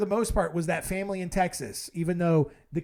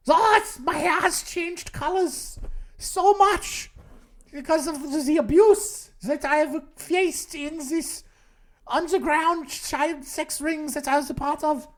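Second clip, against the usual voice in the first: put-on German accent; 8 semitones higher than usual. Impostor excusing his changed hair, put-on German accent. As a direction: plead in a thick German accent. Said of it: "this increasingly German accent you're talking with".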